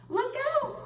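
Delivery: very high alarmed cry; warning cry. A narrator's reading. Angelina warns Josefina of the coyote, very high. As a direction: warn her friend urgently. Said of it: "cried Angelina".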